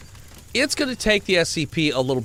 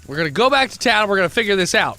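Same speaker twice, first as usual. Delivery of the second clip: urgent command; 7 semitones higher, 5 dB louder, faster than usual. Acted.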